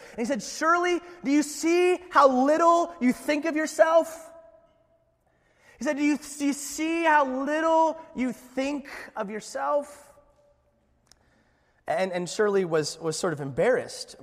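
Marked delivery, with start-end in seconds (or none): 0.4-4.3 loud, high-pitched rebuke; scolding. Acted. Spiritual guru scolding his pupil Shirley, raised scolding voice. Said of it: "he scolded her". 6.0-10.1 high-pitched, insistent rebuke; scolding. Acted. Guru repeating his rebuke to Shirley, scolding. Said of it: "he scolded her".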